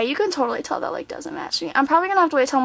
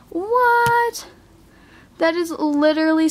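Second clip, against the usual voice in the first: sharp high gasp of a question; stunned by how pretty it looks; amazed; 3 semitones higher, slower than usual. Real, not acted. Seeing her highlighter swatch, stunned and high.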